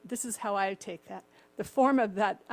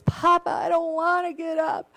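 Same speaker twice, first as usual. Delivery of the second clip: put-on higher, louder whining kid voice; whiny son; 8 semitones higher, 7 dB louder than usual. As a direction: whine like a kid who won't get out of bed. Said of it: "the son goes".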